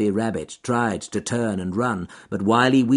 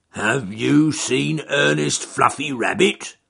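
growled; 6 semitones higher, 9 dB louder than usual. Acted. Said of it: "growled Charles Growly Bear".

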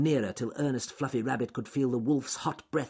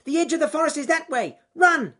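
high, fast, urgent rabbit yell; shouted warning; 11 dB louder than usual. Acted. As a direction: shout directions to someone fleeing. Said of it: "he shouted at the top of his voice".

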